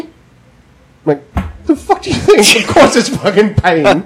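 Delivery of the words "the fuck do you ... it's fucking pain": high, laughing mock fury; laughing while retelling a furious reply to the dentist; laughing outrage. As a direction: rant through laughter, pitched high. Angry retort, laughing.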